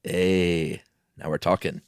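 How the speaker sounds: ordinary delivery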